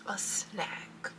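soft secretive whisper; whispered reveal. Acted. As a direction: whisper the word snack. Playful reveal of snack.

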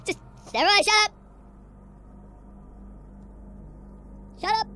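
panicked shout. Real, not acted. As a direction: yell to silence the noise. Panicking while chased.

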